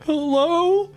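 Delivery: high muffled call through a door; muffled hello. Acted. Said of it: "a muffled".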